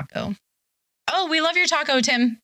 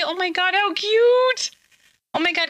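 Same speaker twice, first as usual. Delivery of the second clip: gushing over cute; 9 semitones higher than usual. Real, not acted. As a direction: squeal at a cute puppy. Reacting to puppy photo.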